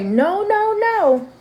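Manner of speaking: put-on high child's firm refusal; high little-kid no, no, no; imitating grandson. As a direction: voice the toddler saying no. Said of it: "once my grandson say".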